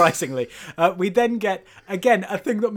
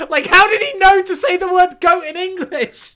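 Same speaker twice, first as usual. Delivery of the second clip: incredulous; 11 semitones higher, 8 dB louder than usual. Real, not acted. S1 exasperated at plot hole.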